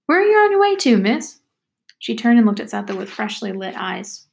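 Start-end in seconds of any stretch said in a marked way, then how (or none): 0.0-1.4 high, louder singsong question; high-pitched backwoods girl prying; talkative country girl, curious. Acted. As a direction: call the question out high and girlish.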